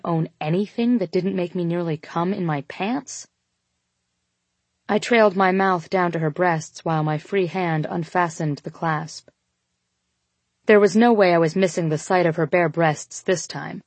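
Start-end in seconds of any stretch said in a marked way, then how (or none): none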